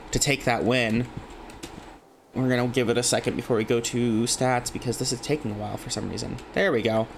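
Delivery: ordinary talk, nothing marked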